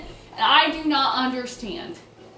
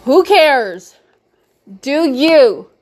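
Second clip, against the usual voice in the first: emphatic; 12 dB louder, much slower than usual. Real, not acted. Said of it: "Hear my heart when I say".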